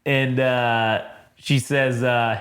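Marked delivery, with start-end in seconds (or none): none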